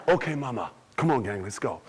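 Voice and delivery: low Jackie voice. Acted. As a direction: answer Mama in a low, easy voice.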